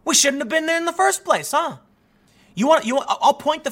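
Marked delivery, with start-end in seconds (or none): 0.0-1.8 high, loud exasperation; exasperated. Real, not acted. Ranting to viewers about Afghanistan, exasperated and high. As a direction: throw up your hands, pitched way up. Frustrated rant about the war.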